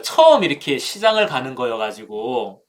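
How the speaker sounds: ordinary delivery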